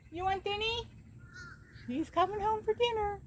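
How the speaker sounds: high cooing voice to the cat; pet talk. Real, not acted. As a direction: owner cooing to her cat about dinner.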